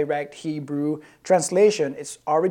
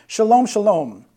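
"Shalom, shalom" higher and much louder; emphatic doubling; 6 semitones higher, 9 dB louder than usual. Shown as a demonstration. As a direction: ring out both words of "Shalom, shalom". Preacher doubles word for emphasis.

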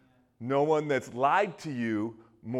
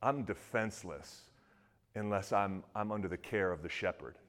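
humble confession; 6 semitones lower, 7 dB quieter than usual. Retold or imitated. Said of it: "he says".

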